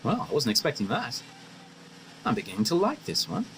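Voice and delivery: soft musing to oneself; quiet aside. Acted. Visitor privately approving of girl, softly musing aside. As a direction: drop the level and confide it to yourself.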